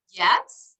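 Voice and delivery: ordinary delivery, nothing marked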